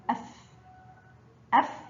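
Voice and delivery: أف ending on a pure f; letter with sukun. Shown as a demonstration. Teacher shows fa with sukun, أف closed on f. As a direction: say أف as one isolated closed syllable. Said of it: "حرف الفاء فوقه سكون ينطق".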